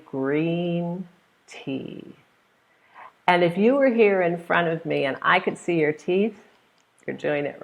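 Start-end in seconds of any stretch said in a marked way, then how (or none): 0.0-2.2 "Green tea" said with a broad smile; smiling green tea. Shown as a demonstration. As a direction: spread the lips wide on "Green tea". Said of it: "you're gonna say".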